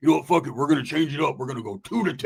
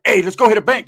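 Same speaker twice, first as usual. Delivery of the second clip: loud, raised-pitch rallying call; eager proposal; 4 semitones higher, 9 dB louder than usual. Retold or imitated. Pitching a heist to Roach.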